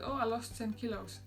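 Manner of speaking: ordinary delivery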